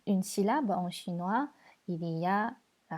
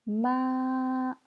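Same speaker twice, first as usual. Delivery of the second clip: "Ma" held high and level; first tone; 4 semitones higher than usual. Shown as a demonstration. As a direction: sustain each "Ma" on one high note. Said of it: "je répète les quatre tons".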